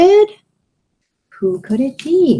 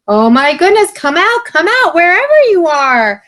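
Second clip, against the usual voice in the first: high, loud wolf call, hammed up; mock wolf bellow; 8 semitones higher, 4 dB louder than usual. Bo Peep retelling the wolf's call, mock bellow. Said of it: "and he bellowed".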